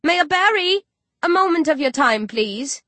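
high, loud call across a square. A narrator's reading. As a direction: shout to get her attention. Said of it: "shouts out".

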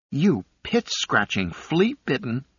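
high-pitched sputtered outrage, cut off short; indignant insult. A narrator's reading. Galet curses Fibbin after landing in dirt, indignant. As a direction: spit out the insult, pitch climbing.

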